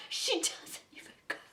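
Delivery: softly whispered, breathy quote; a sly whispered movie quote aside; whispered Mean Girls line. Retold or imitated. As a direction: whisper it like a secret jab. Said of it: "I felt like just standing up and saying that".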